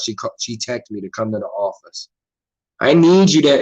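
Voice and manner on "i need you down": higher, louder mock yell; all-caps yelling. Coworker texting him to the warehouse office, mock yell. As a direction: imitate a text typed in capitals, punched louder. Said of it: "It's all caps and exclamation points".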